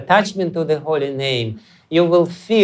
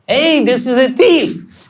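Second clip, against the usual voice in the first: high, loud cry of a woman catching a thief; loud gopi shout; 10 semitones higher, 5 dB louder than usual. Retold or imitated. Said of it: "a loud voice of a gopi".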